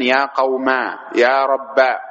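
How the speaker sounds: "قوما" and "ربا" ending in long aa; ya turned alif. Shown as a demonstration. Teacher showing alif form, "قوما" and "ربا" with long final aa. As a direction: say qawmaa, rabbaa for "قوما", "ربا". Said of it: "تقلب الياء ألفًا".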